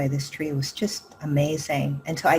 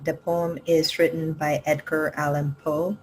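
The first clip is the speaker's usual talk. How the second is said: ordinary talk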